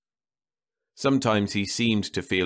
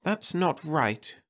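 slow, gentle scold; a wife gently scolding her husband; scolding softly; 9 semitones higher, slower than usual. A narrator's reading. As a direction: chide him softly and slowly. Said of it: "she scolded softly".

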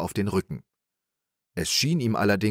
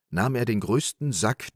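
ordinary delivery

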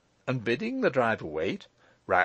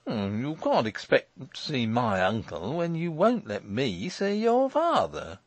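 aggrieved Morris; 2 semitones higher than usual. Acted.

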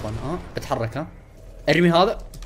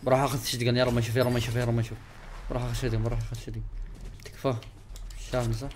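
softer, murmured pleas not to be seen; hushed nervous prayer; 6 semitones lower, 11 dB quieter, faster than usual. Real, not acted. Hiding gamer begging not to be spotted, hushed. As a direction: pray under your breath while hiding. Hiding from enemy, praying quietly.

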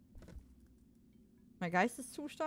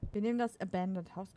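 ordinary delivery

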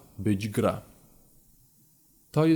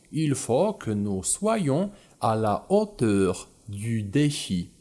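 slow careful French; 3 semitones lower, slower than usual. Shown as a demonstration. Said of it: "reads the French flashcard".

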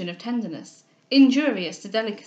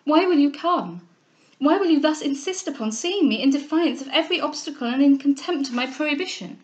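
higher, louder indignant questioning; heated, raised-pitch reproach; agitated cry; 5 semitones higher, 4 dB louder than usual. Acted. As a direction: demand an answer in distress. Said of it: "in a hurrying manner".

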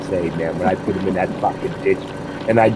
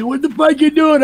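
pitched up high, imitating a startled buddy; mock buddy yell; 4 dB louder than usual. Retelling his buddy's reaction to the sparking plow, high mock yell. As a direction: throw out the buddy's baffled shout, hammed up. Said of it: "My buddy".

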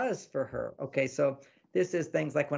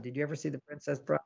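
normal talking voice

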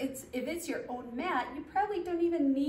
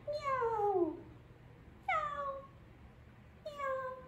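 high, soft kitten meow; cat meow; 13 semitones higher, 7 dB quieter, much slower than usual. Shown as a demonstration. Instructor answering what the cat says, high soft meows. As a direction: mew softly up high like a cat. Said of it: "what does the cat say".

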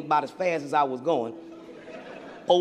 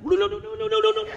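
慌てた高い叫び声; panicked high yelp; faster than usual. Retold or imitated. Panicked billionaire watching crash.

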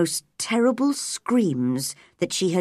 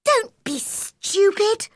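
high, slow, emphatic scolding; angry cry. A narrator's reading. Girl scolds the weeping boy, crying out crossly. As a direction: cry out impatiently. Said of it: "cried Mary".

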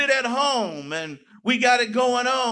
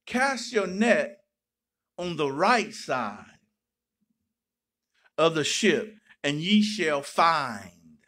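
slow, lower, calm voice of Jesus; 7 semitones lower, slower than usual. Retold or imitated. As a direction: give the command slowly and calmly. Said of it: "But then Jesus says".

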